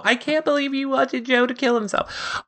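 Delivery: high, outraged fan voice; mock shocked emailer. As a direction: play a scandalised viewer writing in. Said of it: "people go".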